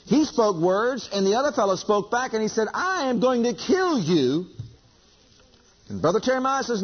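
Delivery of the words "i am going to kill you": slow, raised retold threat; acted death threat. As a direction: draw out the gunman's threat, pitched up. Retold gunman's threat in sermon.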